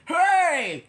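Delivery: loud, high hey; big fan's shout; shouted call. A narrator's reading. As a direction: holler from the bleachers. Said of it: "shouted a fan in the bleachers".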